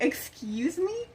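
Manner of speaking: high, drawn-out indignant question; offended disbelief. Retold or imitated. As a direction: stretch it out, rising in affronted disbelief. Acted retort after being told off.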